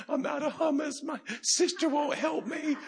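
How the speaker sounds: high, loud comic whine; exaggerated high-pitched mock complaint; hammed-up whining Martha. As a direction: whine like an aggrieved sister tattling. Mocking Martha's kitchen complaint.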